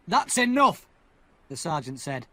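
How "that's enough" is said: high, loud order; loud command. Acted. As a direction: cut them off sharply. Sergeant stops the quarrel.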